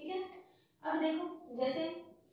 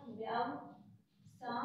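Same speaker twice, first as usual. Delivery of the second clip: व्यव-सा stretched out syllable by syllable; drawn out slowly; 3 semitones lower, 6 dB quieter, much slower than usual. Shown as a demonstration. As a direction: spell out व्यव-सा slowly for the class. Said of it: "as it is आ जाएगा".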